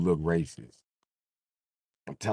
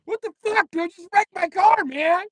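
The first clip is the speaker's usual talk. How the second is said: high squeaky outraged yell; 6 dB louder than usual. Retold or imitated.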